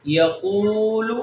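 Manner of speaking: یقول slow, with qoo held; long vowel. Shown as a demonstration. Teacher drills wao madda, یقول drawn out. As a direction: stretch the oo of یقول. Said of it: "یہاں پر واو مدہ اب practice".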